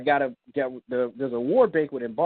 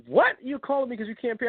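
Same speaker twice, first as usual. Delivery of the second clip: incredulous; 9 semitones higher than usual. Retold or imitated. Incredulous reply to friend.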